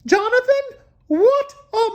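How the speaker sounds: mother yelling. A narrator's reading. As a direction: yell his name like a cross mom. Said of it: "She yelled".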